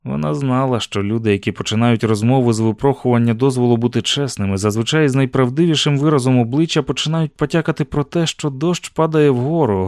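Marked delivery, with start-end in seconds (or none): none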